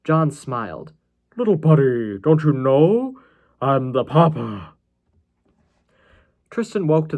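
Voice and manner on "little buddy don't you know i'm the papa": loud, drawn-out put-on madman voice; theatrical, deranged taunt; creepy villain reveal. A narrator's reading. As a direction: slowly savor the big reveal. Villain's smiling reveal in the nightmare.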